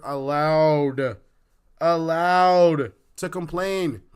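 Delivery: "allowed" drawn out and loud, both times; stress on allowed. Real, not acted. Hammers repeated word in rant.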